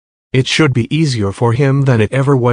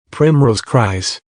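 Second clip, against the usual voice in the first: usual speaking voice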